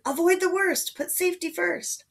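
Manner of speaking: high-pitched urgent advice; calling out. A narrator's reading. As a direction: call a caution across the yard, starting loud. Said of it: "Fee called out".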